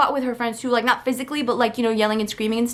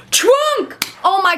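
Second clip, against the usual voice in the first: Trunk! yelped high and loud in sudden recall; the word finally pops into her head; sudden eureka; 8 semitones higher, 4 dB louder, slower than usual. Real, not acted. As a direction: blurt it out the moment it comes back to you.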